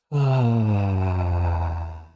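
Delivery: long, drawn-out sighing exhale; demonstrated sigh, sustained and easing away. Shown as a demonstration. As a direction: let a big breath out on a slow "ah". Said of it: "It is the simultaneous sigh".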